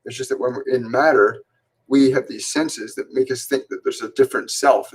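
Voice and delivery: usual speaking voice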